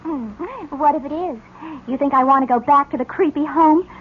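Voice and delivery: ordinary talk, nothing marked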